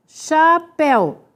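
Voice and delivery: "chapéu" slowly as cha, péu; syllable by syllable. Shown as a demonstration. Teacher showing the stressed syllable, "chapéu" slowly divided. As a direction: split "chapéu" into two drawn-out beats.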